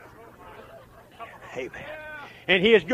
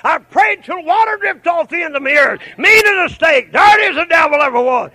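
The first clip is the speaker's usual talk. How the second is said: revival-tent holler; full-force preaching; 12 semitones higher, 13 dB louder than usual. Real, not acted. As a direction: shout it out like a fired-up preacher.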